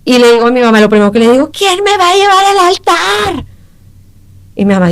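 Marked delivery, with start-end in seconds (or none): 1.5-3.4 high, drawn-out reenacted wail; anguished wail. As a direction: cry out slowly in high-pitched despair, retelling it. Retells wailing to her mother. (lamento agudo y alargado, recreado)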